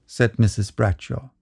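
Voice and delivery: ordinary delivery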